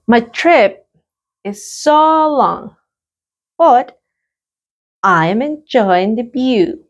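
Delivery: slow, clear model answer; a teacher's slow model answer. Shown as a demonstration. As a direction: lift and stretch so long. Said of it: "Let's practice the pronunciation".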